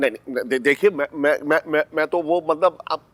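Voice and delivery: tongue-tied, stumbling excuse; flustered stammering. Acted. Accused man trying to explain himself to an angry woman, flustered. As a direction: stammer and restart while caught out.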